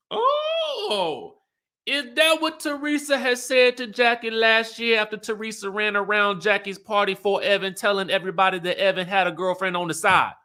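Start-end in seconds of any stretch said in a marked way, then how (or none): 0.1-4.3 very high, drawn-out "oh"; a high squeal of feigned realization; mock surprise. Mock surprise at retold remark.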